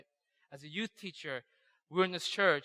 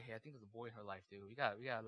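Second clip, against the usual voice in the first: low, much softer aside; hushed confiding; 7 semitones lower, 15 dB quieter, faster than usual. Acted. Youth teacher quietly conferring with his colleague, hushed and low. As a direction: confer under your voice, low.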